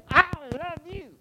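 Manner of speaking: shouted I love you. Shown as a demonstration. Preacher demonstrating a bad tone of voice, loud shout. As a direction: bark the words loud and high, not tender. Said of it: "I don't know if you say it that way".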